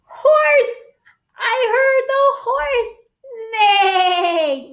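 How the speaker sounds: thrilled high call; excited cry. Acted. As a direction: shout it out with glee for the kids. Announcing the sound button.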